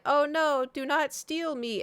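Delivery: high hammy little bird panic; high, slowed mock plea; goofy mock panic. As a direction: play a cartoonish captive bird. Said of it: "it's all very, you know, goofy".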